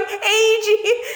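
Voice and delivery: high, laughing parody of a raspy yell; mocking her voice. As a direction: copy her bullhorn call for a joke. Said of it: "giant people, like, going".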